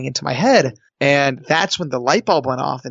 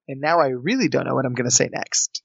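ordinary delivery

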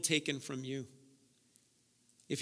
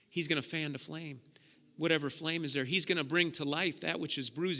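ordinary talk, nothing marked

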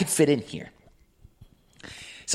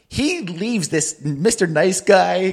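usual speaking voice

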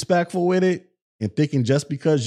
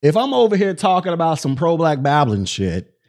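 ordinary talk

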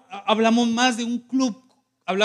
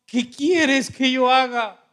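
trembling, fearful; 3 semitones higher, slower than usual. Acted. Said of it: "él temblando y temeroso dijo".

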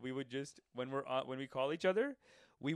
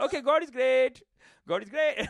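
loud, higher sign-off in a put-on Arabic accent; mock Arabic accent; 8 semitones higher, 8 dB louder than usual. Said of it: "they just end the deal with".

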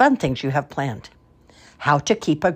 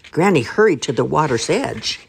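ordinary delivery, nothing marked

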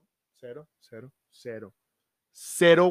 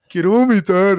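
louder, lightly voiced queasy girlfriend; a hint of drunk nausea; sick drunk girlfriend; 7 dB louder than usual. Retelling his girlfriend feeling sick, mild mock queasy plea. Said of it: "empieza Mariana".

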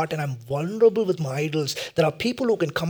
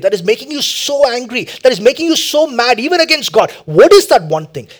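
urgent questions climbing higher and louder; building preaching; 5 semitones higher, 11 dB louder than usual. Real, not acted. Preacher pressing listeners on their one longing, building intensity. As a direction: build the list to a forceful peak.

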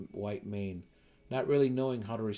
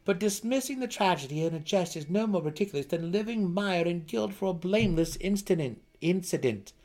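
higher, louder earnest plea; suddenly serious; 9 semitones higher, 6 dB louder than usual. Acted. Driz urging Belwer to drop his guilt, serious and earnest. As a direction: turn grave and press it home. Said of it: "his tone suddenly serious".

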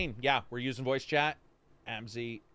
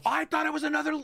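very high-pitched mock bewilderment; incredulous, squeaky confusion; baffled protest; 13 semitones higher, 3 dB louder, faster than usual. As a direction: act out being totally lost. Acting out own bafflement.

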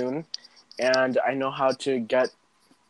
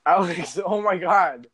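laughing; 5 semitones higher, 7 dB louder, slower than usual. Real, not acted. Laughing exclaim.

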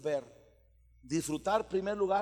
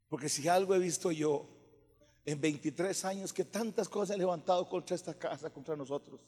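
ordinary delivery

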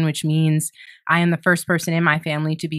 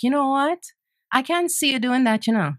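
Jamaican grandmother; 7 semitones higher than usual. Retold or imitated. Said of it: "my grandmother, she had came from Jamaica".

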